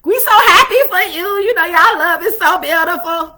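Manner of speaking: very high, loud squeal of delight; squealing girlfriend. Retold or imitated. Friends hyping her new boo, squealing high and loud. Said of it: "You know how our friends is".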